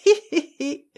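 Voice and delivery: high, brittle hee-hee giggle; acted titter. A narrator's reading. Lady playing with her fan titters at the promise. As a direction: titter coquettishly in short high bursts. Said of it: "fit la dame en jouant de l'éventail".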